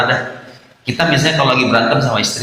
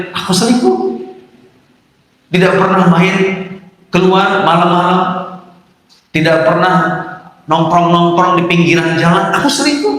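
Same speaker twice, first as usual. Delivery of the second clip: higher, mock-admitting sarcasm to the wife; pitched-up ironic denial; sarcastic retort; 5 semitones higher than usual. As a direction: throw the accusation back ironically.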